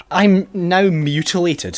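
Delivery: slow, high, loud indignant complaint; mock outrage. Real, not acted. Streamer whose character lost a hand, slowly outraged. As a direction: drag it out in comic outrage.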